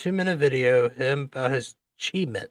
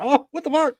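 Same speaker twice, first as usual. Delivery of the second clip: shocked; 12 semitones higher, 3 dB louder than usual. Retold or imitated. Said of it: "I was like".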